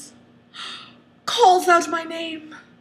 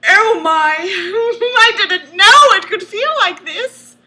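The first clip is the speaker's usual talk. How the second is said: loud, high mock ecstasy; overdone swooning delight; hammed-up rapture; 7 semitones higher, 8 dB louder than usual. A narrator's reading. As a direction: gush breathlessly for laughs. Narrator's rapture in story.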